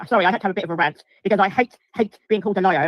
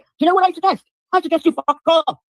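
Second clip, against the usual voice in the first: high, louder furious telling-off; furious, telling a viewer to fuck off; mad; 9 semitones higher, 5 dB louder than usual. Real, not acted. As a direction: tell the heckler where to go, livid. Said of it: "Because I am mad".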